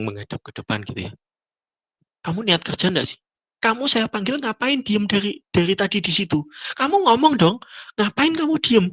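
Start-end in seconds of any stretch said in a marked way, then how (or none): 2.3-8.9 high-pitched exasperated telling-off; mock indignant rant, climbing in pitch; imitated scolding boss. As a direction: act out a boss berating staff, building higher. Imitated boss scolding silent employee.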